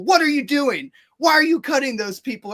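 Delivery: high, loud scolding questions; imitated airport clerk yelling; retold yelling. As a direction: keep hammering him like an angry clerk. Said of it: "starts yelling at me".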